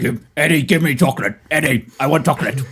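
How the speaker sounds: lightly monstrous symbiote voice; a creature begging for a treat; Venom. Acted. Symbiote begging its host Eddie for chocolate, creature voice. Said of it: "Venom just goes".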